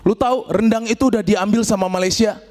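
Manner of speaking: ordinary talk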